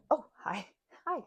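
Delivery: ordinary talk, nothing marked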